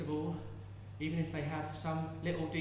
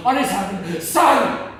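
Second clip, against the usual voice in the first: builds to a loud, slow cry on the last words; shouted curse; 4 semitones higher, 16 dB louder, much slower than usual. Retold or imitated. Mother accusing God of heartlessness, building shout. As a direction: swell into a roar of accusation. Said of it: "shouting these curses".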